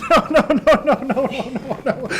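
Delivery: high, laughing run of no's; can't believe it, laughing through; a real mix of laughter and dismay; laughing despair. Real, not acted. Reacting to losing the clue.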